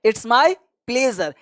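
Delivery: "it's my pleasure" stretched very slowly, "my" stressed; slow it down so learners can repeat "it's my pleasure"; teacher dictating "it's my pleasure" slowly; slow model phrase. Shown as a demonstration. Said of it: "ye चारों का जवाब आपको देना".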